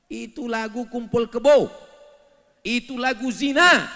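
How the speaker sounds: loud scolding; loud condemnation. Acted. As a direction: denounce it from the pulpit. Preacher condemning songs.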